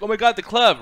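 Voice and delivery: high, loud sarcastic gasp; sarcastic mock awe at the club arriving; mock excitement. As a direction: fake fan thrill, sarcastic. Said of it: "and it's like".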